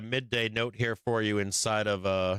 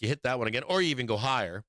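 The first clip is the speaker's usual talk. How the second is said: usual speaking voice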